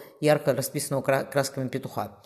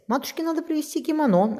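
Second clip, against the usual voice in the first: put-on higher-pitched, mildly worried boy; concerned; 13 semitones higher than usual. A narrator's reading. Boy planning a gift for his mother, concerned. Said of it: "озабоченно сказал Уильям".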